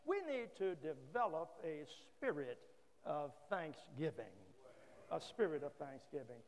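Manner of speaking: ordinary talk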